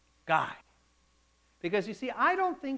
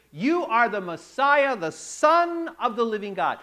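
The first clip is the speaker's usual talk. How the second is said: bursting out; 2 semitones higher, 7 dB louder than usual. Retold or imitated. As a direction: blurt it out with triumphant certainty. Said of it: "he bursts out his answer".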